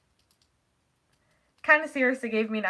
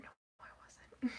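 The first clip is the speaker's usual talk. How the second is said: hushed whispered aside; whispering; 15 dB quieter than usual. Real, not acted. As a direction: drop to a whisper. Jokey correction after quoting herself.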